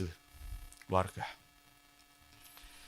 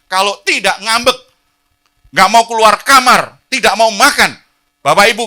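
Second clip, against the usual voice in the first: loud, high, driving sermon voice; fiery preaching; 12 semitones higher, 16 dB louder than usual. Acted. Pastor exhorting parents from the pulpit, loud fiery preaching. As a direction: preach it hard to the congregation.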